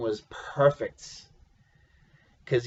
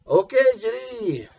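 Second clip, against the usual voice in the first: higher-pitched little French Canadian voice; French Canadian accent; 9 semitones higher than usual. Retold or imitated. Father agreeing to lend his son the car, French Canadian accent. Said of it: "in his little French Canadian accent".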